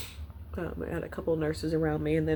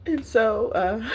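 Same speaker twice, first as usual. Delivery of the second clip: choked up; 6 semitones higher, 7 dB louder, slower than usual. Real, not acted. Overcome, pauses and apologizes.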